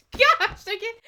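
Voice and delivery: loud, high squeal of giving up; laughing exclamation; overcome with giggles. Real, not acted. Overcome by laughter while reading.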